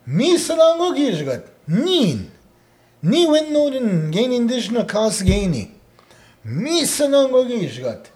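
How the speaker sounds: high, loud, emphatic proclamation; expressive declaration. Shown as a demonstration. Teacher shows learner how to say it well, loud expressive declaration. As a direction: proclaim it with feeling behind every phrase. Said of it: "Like, say it well".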